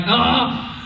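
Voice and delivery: ordinary talk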